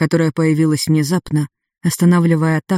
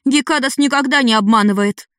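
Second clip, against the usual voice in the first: angry; 7 semitones higher than usual. A narrator's reading. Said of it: "рыжая рассердилась".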